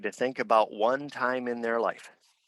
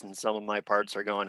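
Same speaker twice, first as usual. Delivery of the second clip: normal talking voice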